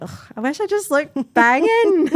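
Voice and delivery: high whiny complaint. Retold or imitated. Quoting own annoyed thought.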